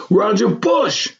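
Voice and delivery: high, drawn-out name call; excited holler. A narrator's reading. Man greeting old schoolmate up close, excited holler. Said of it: "Hawk said again".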